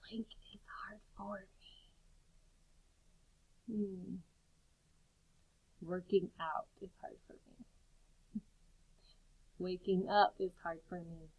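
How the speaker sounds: soft whispered sentences; hushed partner-talk voice; quiet whisper. Shown as a demonstration. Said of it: "in a whisper voice".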